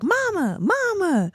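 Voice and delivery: very high-pitched mock child calling mom; imitating clerk's child. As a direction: squeal it like a kid on speakerphone. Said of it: "And she's like".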